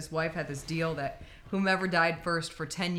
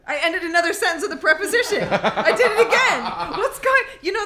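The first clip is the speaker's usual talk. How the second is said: laughing through the words, pitched up and louder; 7 semitones higher, 9 dB louder than usual. Real, not acted. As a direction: giggle it out at your own slip. Laughing at own grammar slip.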